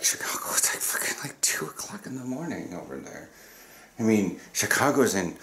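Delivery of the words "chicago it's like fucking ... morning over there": hushed, voiceless aside of disbelief; whispered disbelief. Real, not acted. Whispering to the camera during a work call, incredulous. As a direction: whisper it so the meeting can't hear. Muttering disbelief at the meeting time.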